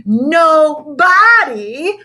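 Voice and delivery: long drawn-out howl, high and loud; stretched, high howl of glee. A narrator's reading. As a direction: howl it out with laughter. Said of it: "howled Lenny".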